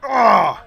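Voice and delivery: loud, sharp grunt of pain. Acted. The hit brute cries out, a loud pained grunt. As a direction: grunt hard as if the bullets just hit. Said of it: "He cries out in pain".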